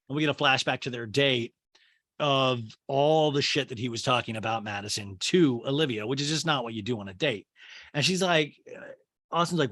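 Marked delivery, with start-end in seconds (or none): none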